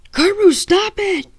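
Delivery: high, slow yell. A narrator's reading. Zack yelling at the werewolf to stop, high and slow. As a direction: shout him off the fight. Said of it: "Zack yelled".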